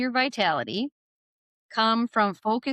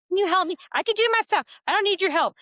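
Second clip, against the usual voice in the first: put-on much higher, louder child voice, wavering between help and defiance; a stubborn small child insisting; little kid voice; 12 semitones higher, 5 dB louder, much faster than usual. Little kid wanting help yet refusing it, high child voice. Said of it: "little kids are like".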